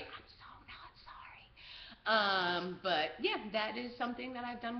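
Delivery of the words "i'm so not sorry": slow, gleeful stage whisper; confiding whisper. Real, not acted. Confessing behind mom's back, slow whisper. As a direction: whisper it slowly, like a naughty secret. Confides after apology to mom.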